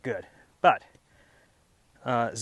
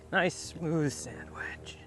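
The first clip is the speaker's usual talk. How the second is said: slow hushed golf commentator whisper; 4 semitones higher, much slower than usual. Pretend. Whispered call of own shot.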